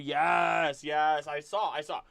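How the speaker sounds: normal talking voice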